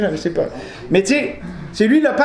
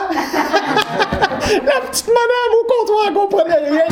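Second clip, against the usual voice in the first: laughing, pitched up, slowed; high laughing; 12 semitones higher, slower than usual. Real, not acted. Preacher cracking up about the clerk at the counter. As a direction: squeal it out through laughter. Laughing while telling anecdote.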